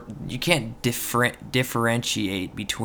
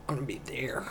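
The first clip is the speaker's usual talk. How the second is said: whisper; 3 semitones higher, 9 dB quieter, faster than usual. Pretend. Speaking from beyond the grave.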